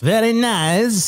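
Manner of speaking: put-on slow Borat-style Kazakh accent. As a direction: drawl it out like Borat. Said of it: "I just did the accent".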